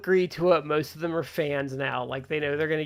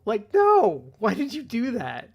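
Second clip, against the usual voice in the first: higher-pitched pleading disbelief; exasperated dismay; 6 semitones higher, 4 dB louder, slower than usual. Retold or imitated. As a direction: react aghast at a friend's blunder. Reenacting reaction to the scam.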